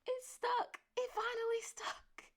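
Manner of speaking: mother learning her lesson stuck, squealing high; thrilled disbelief. Retold or imitated. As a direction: gasp with delight that it worked. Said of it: "I was like".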